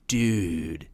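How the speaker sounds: low, drawn-out surfer dude voice; laid-back surfer. Shown as a demonstration. Host demonstrating surfer lingo to guest, laid-back surfer drawl. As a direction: say it like a mellow surfer. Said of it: "It's like this laid back".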